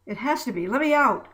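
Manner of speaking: insist, then explode; angry and upset. A narrator's reading. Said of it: "Angry and upset".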